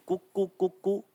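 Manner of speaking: softly imitated cuckoo call. Shown as a demonstration. Teacher showing students how a koel calls, soft cuckoo imitation. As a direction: imitate a koel's cooing, quietly. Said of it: "कोयल की आवाज कैसी होती है".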